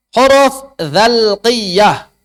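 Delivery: very slow, stretched term; drawn out. Shown as a demonstration. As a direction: spell out every sound of "dzal qiyah". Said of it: "teaching term slowly". ("dzal qiyah" diucapkan sangat lambat)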